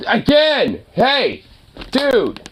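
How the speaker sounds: sudden high, exasperated call; exasperated scolding. Real, not acted. Owner interrupted by his noisy dog, exasperated. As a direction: cut in sharply with rising irritation.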